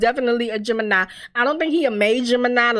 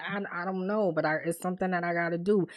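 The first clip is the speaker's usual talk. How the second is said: hushed, low, halting, understated sorrow; retold tearful reply; 5 semitones lower, 10 dB quieter, faster than usual. Man evading his girl's question, quietly sad. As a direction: imitate him quietly stumbling over the answer. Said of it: "and he's crying".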